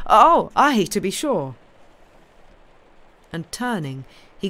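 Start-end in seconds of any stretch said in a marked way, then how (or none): 0.1-1.5 loud, higher burst of agreement; sudden assent. Acted. Mister Jarvie agreeing with his visitor, sudden loud assent. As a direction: break out of the murmur brightly.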